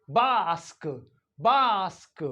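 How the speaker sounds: BASK as 'bahsk', long open vowel; British long A. Shown as a demonstration. Teacher modelling British English, long-ah BASK. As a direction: say bask with the A of father. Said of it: "in British English".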